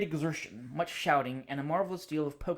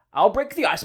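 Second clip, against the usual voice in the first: hearty announcement; 5 semitones higher, 10 dB louder than usual. Acted. Husband announces plan to wife.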